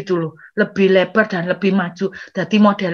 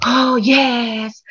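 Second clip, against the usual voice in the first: drawn-out mock relief; long, hammed-up sigh of triumph; relieved colleagues. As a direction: imitate everyone savouring a short break. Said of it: "semua teman-teman langsung".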